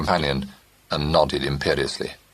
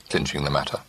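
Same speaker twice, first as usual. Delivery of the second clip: ordinary delivery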